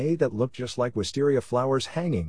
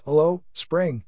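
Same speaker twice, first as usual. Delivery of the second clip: mild, higher-pitched cheerful call; cheerful shout; 7 semitones higher, slower than usual. A narrator's reading. Narrator voices a locust tree greeting spring, mild cheerful shout. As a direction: read it as a lightly cheery shout. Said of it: "cheerfully shouts".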